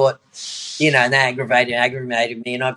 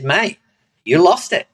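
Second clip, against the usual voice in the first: teasing mate; 6 semitones higher, 5 dB louder than usual. Retold or imitated. Mate refusing to return medal.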